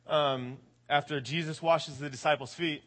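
ordinary delivery

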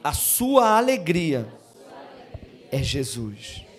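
slow, drawn-out words for the church to echo; phrase for them to repeat. Shown as a demonstration. Pastor gives the church the line to tell a neighbour, slowly. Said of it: "Fala com esse irmão".

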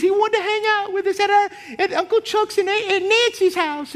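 put-on old aunt reminiscing. Aunt telling her nephew about his dad, high-voiced. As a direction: gush the memory like a doting great-aunt. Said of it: "She goes".

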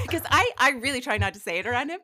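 ordinary talk

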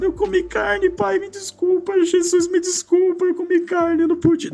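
put-on high, far, sobbing child's plea; child sobbing to his father after eating meat on Good Friday; crying child. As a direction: imitate your small self crying and begging forgiveness. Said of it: "Comecei a chorar".

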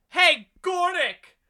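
high, drawn-out heckling shout; loud, stretched mock call to a teacher. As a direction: holler the name across a classroom. Kid heckles the substitute.

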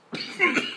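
sudden cough from water down the wrong way; real coughing fit; choking cough. Real, not acted. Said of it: "choking on water".

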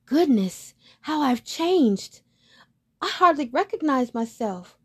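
put-on amazed young swan. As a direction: marvel at his own reflection. Duckling sees his reflection.

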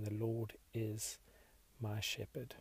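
slow, measured reading with a pause midway; unhurried, meditative verse reading; slow scripture reading. Real, not acted. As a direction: read it slowly and reflectively. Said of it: "I'm gonna read it slowly".

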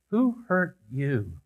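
soft and very slow, word by word; hushed, unhurried, caring question; gentle question. Retold or imitated. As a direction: ask tenderly, giving each word room. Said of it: "looks at him very gently and simply says".

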